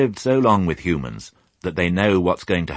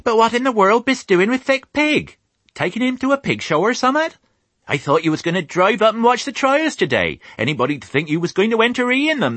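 high, loud rustic farm wife; country wife teasing her husband; chattering farmer's wife; 10 semitones higher, 5 dB louder than usual. Acted. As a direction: rattle on from the kitchen window. Mrs Hoggett chatters from window.

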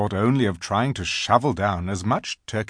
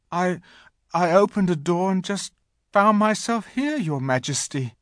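put-on high, hesitant boy's voice; falter, then explain meekly; nervous boy; 10 semitones higher than usual. A narrator's reading. Edmund explains himself to the Queen, nervous.

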